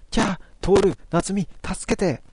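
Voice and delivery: high, slow cry for help; a hammed-up frightened plea; mock scream for help. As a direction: squeal it out like a scared girl. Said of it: "中からアリサの叫び声がした".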